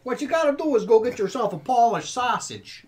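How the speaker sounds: loud, high bad Chicago accent; hammed-up Chicago guy. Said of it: "bad Chicago accents".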